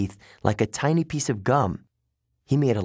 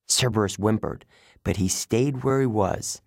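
ordinary delivery

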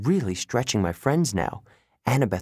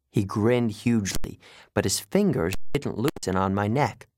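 ordinary delivery, nothing marked

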